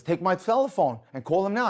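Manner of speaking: retold insistent command; urgent order. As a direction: press him to act at once. Driver orders him to phone.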